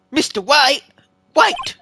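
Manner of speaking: the lawyer hearing the villain's name, a sharp high shout; startled. Real, not acted. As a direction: jolt as the name clicks. Sudden recognition of a name.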